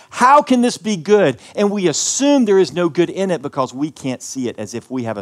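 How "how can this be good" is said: higher, louder, slow indignant question; doubting complaint. Retold or imitated. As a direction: protest to God, raised and drawn out. People complaining to God.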